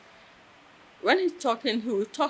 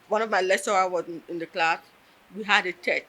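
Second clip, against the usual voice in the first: ordinary talk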